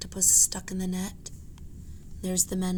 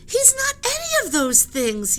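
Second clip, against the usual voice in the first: high, louder defiance; defiant yell; 5 dB louder than usual. Acted. Annie yells back at the sailors, high and louder. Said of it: "yelled Annie".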